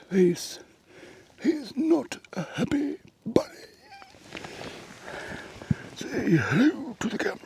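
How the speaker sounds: high, soft whisper to a little animal; cooing whisper. Real, not acted. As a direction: coax the frog gently. Cooing at the frog.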